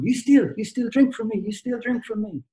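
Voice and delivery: high accusing foreign manager voice; angry manager. Retold or imitated. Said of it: "And he's going".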